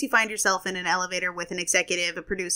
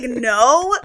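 horrified; 4 semitones higher, 9 dB louder than usual. Retold or imitated.

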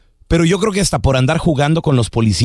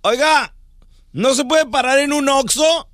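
higher-pitched, pleading arrested man; arrested man's request; 8 semitones higher than usual. Acted. Arrested drunk asking police for a store stop, high pleading request. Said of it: "le dice".

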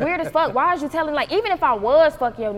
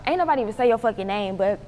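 normal talking voice

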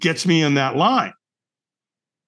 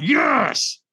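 higher, more forceful Yes; stronger yes; 9 semitones higher than usual. Retold or imitated. Answering the trainer's demand, a more forceful yes.

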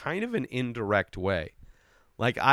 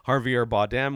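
usual speaking voice

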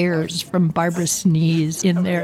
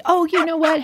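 high fake-casual voice; 9 semitones higher than usual. Dad covering for chaos.

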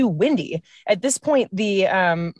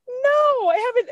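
nervous squeak; slower than usual. Retold or imitated. Scared reply on cliff drive.

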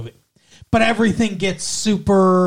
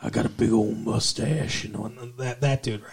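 Sam Elliott impression; 7 semitones lower, 5 dB quieter than usual. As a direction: rumble it low like an old Texas cowboy. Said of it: "kind of deep voice".

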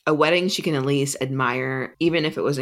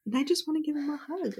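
swooning; 12 semitones higher, 7 dB quieter than usual. Real, not acted. Swooning over the book's hero.

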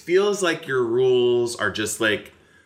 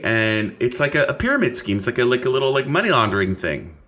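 ordinary talk

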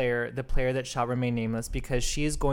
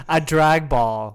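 a drag ball, ball said like bowl in New York; New York accent; 6 dB louder, slower than usual. Shown as a demonstration. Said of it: "I was just pronouncing it with my faux New York accent".

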